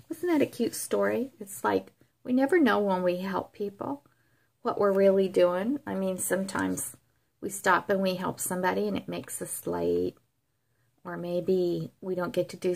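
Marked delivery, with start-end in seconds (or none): none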